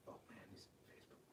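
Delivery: a private whispered aside, away from the audience. Real, not acted. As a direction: whisper it under your breath, nearly silent. Aside muttered off to himself.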